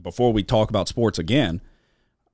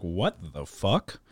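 slow, hushed bafflement, rising at the end; stunned disbelief; 7 dB quieter, slower than usual. Retold or imitated. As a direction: draw it out quietly, baffled. Retelling stunned reaction to the video.

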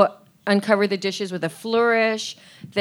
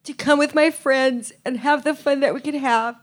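mock gushing Teresa; 5 semitones higher than usual. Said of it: "get all Teresa on this".

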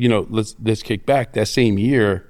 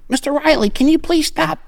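squeaky guard. Retold or imitated. Imitating small security guard.

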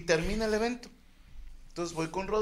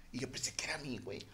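hushed aside; 5 semitones lower, 13 dB quieter, faster than usual. Real, not acted. Confessing he thought he was scolded.

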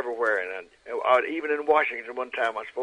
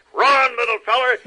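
loud, raised cartoon bulldog voice; Chopper; 12 semitones higher, 13 dB louder than usual. Shown as a demonstration. Bulldog sending his little duck friend running, loud cartoon urgency. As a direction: shout an urgent warning as a cartoon protector. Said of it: "it goes like this".

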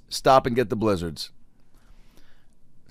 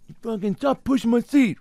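high, strained mock yell at the guy behind; hammed-up annoyed stranger; angry moviegoer; 12 semitones higher than usual. Moviegoer snapping at the guy kicking his seat, in a high strained voice.

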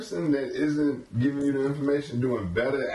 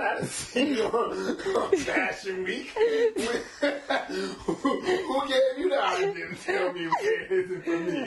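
laughing; 11 semitones higher than usual. Real, not acted. Teasing host about fashion.

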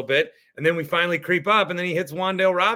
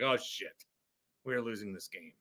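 much softer, sinking realization; mock dejection; 6 semitones lower, 12 dB quieter than usual. Fan seeing the agent and expecting defeat, acted dejection. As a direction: let it drop away as if hope just drained.

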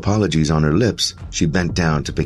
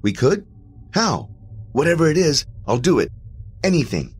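desperate, much higher pleading; eager; 12 semitones higher than usual. A narrator's reading. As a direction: grab at the hope, pitched way up.

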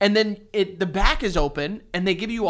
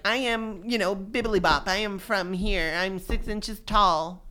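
lightly put-on toy bear voice, softer; bear introducing itself; 3 dB quieter than usual. Voicing the bear's birth certificate, lightly put-on character.

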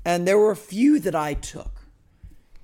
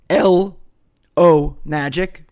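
"L O Magic" louder, each part set apart; clear enunciation; 2 semitones higher, 9 dB louder, much slower than usual. Shown as a demonstration. Host giving listeners a promo code, "L O Magic" clearly enunciated. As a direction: spell out "L", "O", then "Magic" slowly and loudly. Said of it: "I'll try and be clear".

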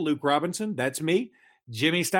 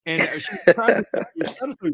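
talking through a laugh; 7 semitones higher, 5 dB louder, faster than usual. Real, not acted. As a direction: laugh while getting the words out. Laughing while retelling.

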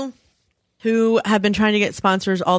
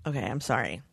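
ordinary delivery

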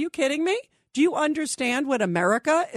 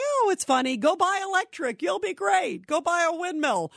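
higher, sing-song mock of a smug official; mocking dismissal; 6 semitones higher than usual. Host mocking officials who laugh off inflation, high mocking voice. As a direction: scoff it off like it's all a joke. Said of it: "they're just kinda laughing".

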